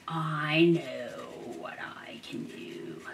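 soft unvoiced whisper. Real, not acted. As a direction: murmur it as a secret plan. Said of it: "whispering to herself".

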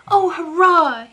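high, slow delighted cry of triumph; joyful cry. A narrator's reading. Boy Lionel cheering as the dragon is trapped, high excited cry. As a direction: cry out hurrah with joy. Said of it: "he cried".

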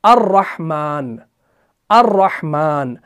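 "अर्रहमान" very slow with a doubled r; slow model reading of "अर्रहमान"; Arabic recitation. Shown as a demonstration. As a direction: stretch out "अर्रहमान", rolling the double r. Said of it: "हमजा को रॉ के साथ मिलाना है".

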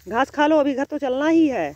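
loud coaxing call. Real, not acted. Owner urging the running pet to eat, loud call. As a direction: shout it out so the pet hears you.

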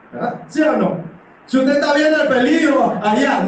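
ordinary talk

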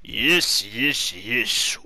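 usual speaking voice

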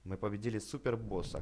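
ordinary talk, nothing marked

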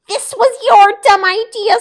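high, loud blaming snap; angry fox. Acted. Fox blames the bear, loud angry.

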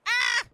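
short, very high and loud shriek; startled gamer shriek; sudden startled scream. Real, not acted. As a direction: yelp as if jumped on. Sudden shock mid-game.

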